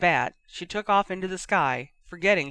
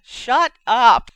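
loud, higher cut-in; indignant shout; 6 semitones higher, 12 dB louder than usual. Acted. Ginny cutting off her teasing brother, indignant shout. Brother teased, cuts him off.

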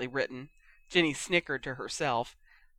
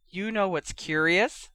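ordinary delivery, nothing marked

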